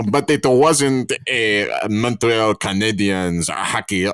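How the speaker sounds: put-on slow storytelling in a Quebecois accent; heavy French Canadian accent, drawn out. Reciting the hockey sweater story to the co-hosts, Quebecois accent. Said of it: "all the Quebecois people".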